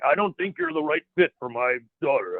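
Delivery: lightly put-on movie dub voice; a hint of badly dubbed older brother; mock dubbed dialogue, lightly voiced; dubbed-film voice. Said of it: "doing it in the dubbed voice".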